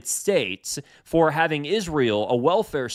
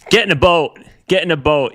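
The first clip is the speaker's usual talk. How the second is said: loud, sneering echo of the line; mocking repeat; 2 semitones higher, 11 dB louder than usual. Commentator mocking the secretary's line, loud sneer. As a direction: throw his words back at him, loud and scornful.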